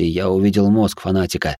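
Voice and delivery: plain reading voice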